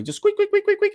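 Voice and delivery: high squeaky mimic of sneakers on a court; squeaky sneaker imitation. Mocking the sneaker squeaks he hates, high squeaky mimicry. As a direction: squeal it like shoes on hardwood.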